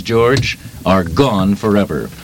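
ordinary talk

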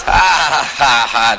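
mock laugh brushing the comic off; imitating a smug, forced laugh; dismissive fake laugh. Censor laughs off the comic's complaint, mock laughter. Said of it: "So there he goes".

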